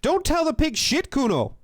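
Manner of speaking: put-on high, loud jeering kid; hammed-up bratty heckler; heckling kid. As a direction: yell it like a kid egging on a friend. Reads game kid's heckling line aloud.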